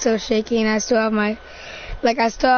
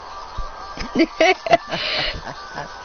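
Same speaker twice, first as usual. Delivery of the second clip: higher mad chuckle; insane laugh; 4 semitones higher, 4 dB quieter than usual. Acted. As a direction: keep laughing as sanity slips. Insane character keeps laughing.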